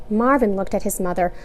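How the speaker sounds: plain reading voice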